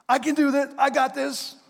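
high, cocky mock voice; mock bravado. Mocking the congregation's bravado, high and cocky. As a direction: play the overconfident rower.

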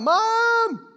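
high, loud frantic calls; a small child shouting for mom in panic; calling for mom. Retold or imitated. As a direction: yell for your lost mother across the store. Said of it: "walking through the store crying out".

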